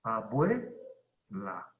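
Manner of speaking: "Abuela" slow, with bue held as one long syllable; diptongo en una sílaba. Shown as a demonstration. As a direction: draw out "Abuela", gliding ue together, then la apart. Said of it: "se pronuncia en una sola sílaba". ("Abuela" pausado, alargando bue en una sola sílaba)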